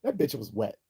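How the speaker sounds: normal talking voice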